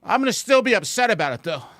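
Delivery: loud, high, hammed-up furious yell; mock upset yelling. Shown as a demonstration. Streamer demonstrating what upset sounds like, loud mock yell. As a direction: scream it like a betrayed partner.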